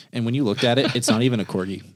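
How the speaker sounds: usual speaking voice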